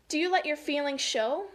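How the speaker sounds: clear, unhurried read-aloud question; emphatic storybook question; picture-book reading. Acted. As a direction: read it out to children with lift.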